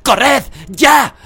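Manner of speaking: full-force high yell; loud, urgent command; urgent shout. A narrator's reading. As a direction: yell a desperate order to flee. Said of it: "gritó Sirius".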